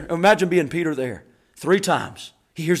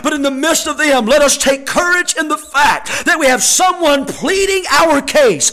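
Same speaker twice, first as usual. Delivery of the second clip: preaching that rises to full force; impassioned pulpit build; building sermon; 6 semitones higher, 7 dB louder than usual. Real, not acted. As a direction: drive it higher, phrase by phrase.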